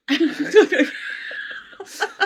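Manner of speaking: breathy high silent laugh; real helpless laugh; unvoiced laugh. Real, not acted. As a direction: break into a wheezing laugh. Laughing at the memory.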